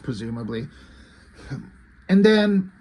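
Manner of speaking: usual speaking voice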